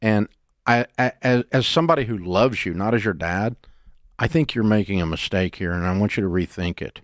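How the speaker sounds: low, tender fatherly counsel; loving appeal. Acted. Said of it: "take his daughter to coffee and say".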